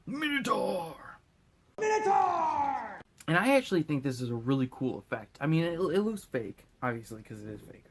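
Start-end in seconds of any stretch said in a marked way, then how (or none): 0.1-3.0 much higher, slow stretched shout; gasp, then bellow the monster's name; dramatic shout. Pretend. Gasped shout naming a monster.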